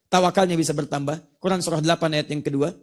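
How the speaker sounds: ordinary delivery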